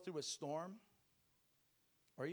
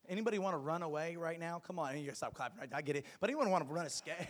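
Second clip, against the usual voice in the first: booming motivational speech; 4 semitones higher, 9 dB louder than usual. Acted. Rallying the congregation.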